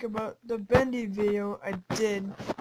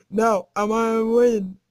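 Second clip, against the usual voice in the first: ordinary talk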